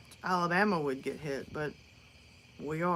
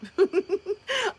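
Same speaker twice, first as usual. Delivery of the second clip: loud high laugh; 12 semitones higher, 4 dB louder than usual. Real, not acted. Laughs at her own joke.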